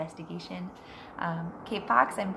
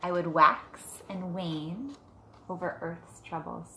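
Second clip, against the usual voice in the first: put-on reading to children; 6 dB quieter than usual. Teacher reading Moon Wishes to her class, gentle storybook voice. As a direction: read it softly to a class of kids. Reads picture book aloud.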